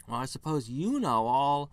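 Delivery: a bunny full of scorn, rolling its eyes; snippy rabbit sneering at the human; sarcastic bunny. Acted. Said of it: "it says, rolling its eyes".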